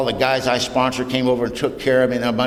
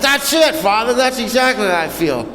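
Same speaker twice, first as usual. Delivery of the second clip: much higher, louder, eagerly agreeing; eager agreement; 10 semitones higher, 3 dB louder than usual. Retold or imitated. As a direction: jump in, thrilled he finally gets it. Eager reply to the priest.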